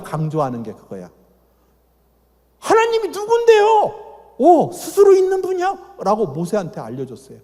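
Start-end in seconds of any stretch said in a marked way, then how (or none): 2.6-6.0 high, puzzled Moses voice asking God his name; voiced Moses asking. Moses asking God his name, high and puzzled. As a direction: play old Moses asking who God is.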